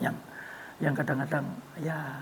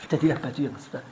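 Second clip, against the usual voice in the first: unvoiced hushed ending; whispered; 4 semitones lower, 4 dB quieter, faster than usual. Son finishes his explanation, whispered. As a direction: drop to a whisper.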